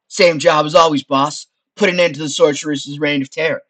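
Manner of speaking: loud, chummy wise-guy skull; Frank. Acted. Skull offering his help to the adventurer, wise-guy voice. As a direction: pitch yourself as the helpful sidekick.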